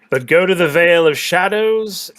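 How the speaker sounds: loud menacing proclamation; threatening boom. Acted. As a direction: bellow the threat. Villain warns threateningly.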